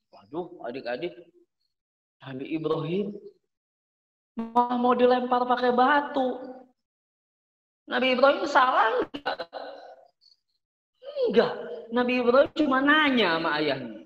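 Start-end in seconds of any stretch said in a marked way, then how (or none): none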